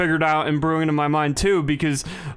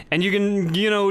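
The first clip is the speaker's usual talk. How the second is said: normal talking voice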